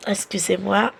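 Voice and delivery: ordinary talk, nothing marked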